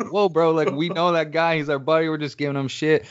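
high-pitched, hasty backing-off voice; retold placating protest. Hecklers explaining themselves to rival fans, high placating protest. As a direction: plead innocence to riled fans.

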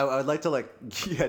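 ordinary delivery

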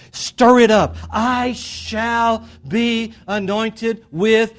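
loud, high, fervent exhortation; impassioned preaching. Real, not acted. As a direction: drive it home to the whole church at full voice. Preaches and prays at full force.

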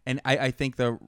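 ordinary talk

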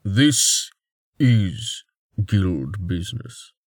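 slow, flat and final; no emotion, just state it. A narrator's reading. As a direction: warrior flatly overruling the lord, slow. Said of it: "Halgrave said flatly".

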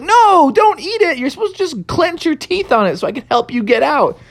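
high, loud, rushed scolding; exasperated high-pitched protest; alarmed protest. Acted. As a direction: cry out in alarm and rattle off the correction. Froggy stops goat eating cape.

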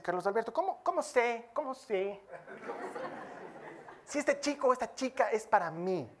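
lightly voiced giggling teenager; giggly teen. Teenager asking pastor about a crush, giggly. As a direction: ask it with a nervous laugh.

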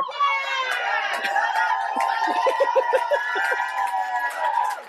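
a burst of laughter at her own joke. Real, not acted. Cracking up at her own line to the crowd, shrieking laugh.

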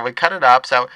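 brash lunch lady talking. Acted. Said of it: "I should just do both voices".

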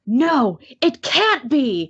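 high, slow, hammy dramatic reading; B movie line. Retold or imitated. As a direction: overact it like a B movie. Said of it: "there is no way to sell this without sounding like a B movie".

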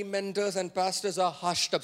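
ordinary talk

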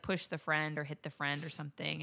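normal talking voice

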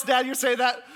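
ordinary talk, nothing marked

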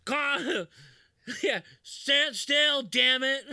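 high, loud panicky pitch; yelling in mock panic at the car; frantic salesman. Retelling the frantic car scene.

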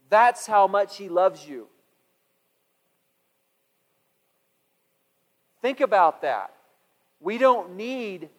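loud, slow, punched preaching; fiery preaching. Acted. Preacher booming to the congregation about God's provision, loud and slow. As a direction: preach it out with full force. Preacher building to full force.

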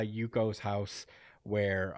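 ordinary talk, nothing marked

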